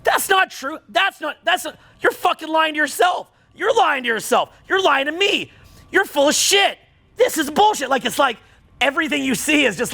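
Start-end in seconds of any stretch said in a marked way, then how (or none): 0.0-7.9 angry rant. Retold or imitated. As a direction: fire it off higher, shouting at the liars. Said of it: "I'm constantly angry because I'm like".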